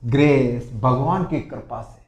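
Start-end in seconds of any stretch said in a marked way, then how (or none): 0.1-0.8 very slow and loud; slow teacher demo; slow model phrase. Shown as a demonstration. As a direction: spell it out for the class. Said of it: "फिर बोलते हैं".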